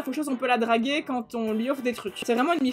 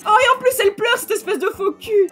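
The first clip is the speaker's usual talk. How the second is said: much higher, louder outraged cry; indignant outburst; 9 semitones higher, 10 dB louder than usual. Real, not acted. Streamer yelling at fake-crying Sim, higher and louder. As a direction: explode at the fake crier.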